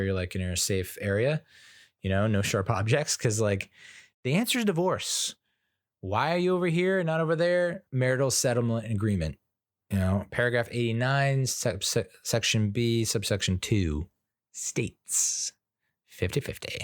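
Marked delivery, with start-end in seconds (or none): 4.2-7.8 higher, singsong explanation; mock-cheery explainer. Dad explaining divorce to his daughter, mock-cheery. 14.5-16.8 soft whispered fifty fifty; whispered legal read. Pretend. Dad quoting settlement to daughter, whispered.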